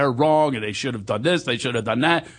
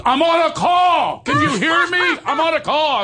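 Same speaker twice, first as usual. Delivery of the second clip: angry caller; 14 semitones higher, 4 dB louder than usual. Retold or imitated. Furious caller to the man on his line, mock angry shout. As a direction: yell it down the phone, stretching the words. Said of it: "this guy is getting angrier and angrier".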